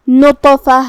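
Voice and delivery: نطفہ with a bounced heavy t; echoing qalqala. Shown as a demonstration. Teacher showing qalqala to learners, نطفہ with echoing ط. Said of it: "طا کو ہلاتے ہوئے".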